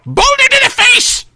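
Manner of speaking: put-on high old man's cry; old man throwing a boulder spell; shouted spell.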